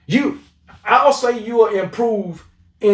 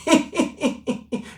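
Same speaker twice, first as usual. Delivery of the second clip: breathy gloating laugh; 6 semitones higher, 5 dB quieter than usual. Real, not acted. Gloating over rival fans.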